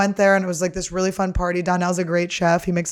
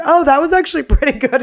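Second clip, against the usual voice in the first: high, loud, laughing through words; 8 semitones higher, 8 dB louder than usual. Retold or imitated. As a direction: say it while cracking up. Host laughing at her set.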